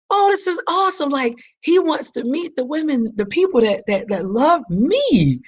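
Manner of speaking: high, giddy and thrilled; giddy delighted gushing. Retold or imitated. Young woman gushing to herself about her boyfriend, high and giddy. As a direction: gush like a smitten girlfriend. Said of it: "what woman isn't like".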